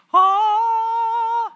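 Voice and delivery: high, loud gasp of surprise; a teenager caught totally off guard; stunned, delighted high "oh". Retold or imitated. Teen stunned by car offer.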